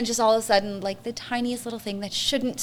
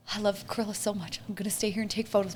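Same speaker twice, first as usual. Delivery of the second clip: quiet; 9 dB quieter than usual. Retold or imitated. Retold holding back tears.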